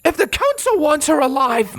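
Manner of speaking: high-pitched, heated accusation; furious confrontation, voice pushed way up; angry demand. Acted. Heated confrontation with her enemy.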